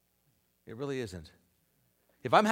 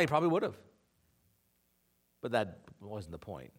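ordinary delivery

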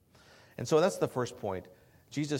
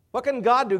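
loud, high and emphatic; 13 semitones higher, 14 dB louder than usual. Shown as a demonstration. Pastor challenging the congregation, loud and emphatic. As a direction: fling the question out to the hall. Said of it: "What can the Holy Spirit do?".

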